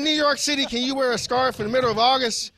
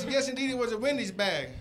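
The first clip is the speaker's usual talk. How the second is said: normal talking voice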